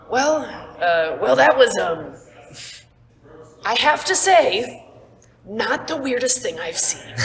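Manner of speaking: a big guy chuckling at oddity; a dragonborn carrying someone, amused. Acted. Dragonborn NPC voiced by GM.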